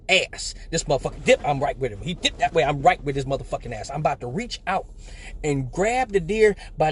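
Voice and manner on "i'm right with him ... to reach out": usual speaking voice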